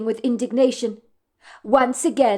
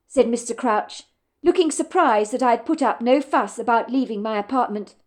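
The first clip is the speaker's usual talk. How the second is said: usual speaking voice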